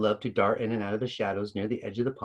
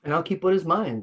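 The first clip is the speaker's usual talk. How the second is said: cold; 6 semitones higher than usual. A narrator's reading. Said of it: "the pond spirit said coldly".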